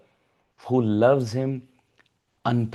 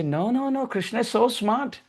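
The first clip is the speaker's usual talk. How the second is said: much higher, eager praise; delighted protest; 9 semitones higher than usual. Acted. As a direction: insist how clever he is.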